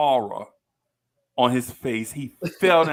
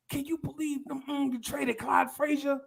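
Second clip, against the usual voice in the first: much higher, softer gossipy tone; mock gossiping teacher; 11 semitones higher, 5 dB quieter than usual. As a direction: gasp it like scandalised teachers. Teachers cussing in courtyard.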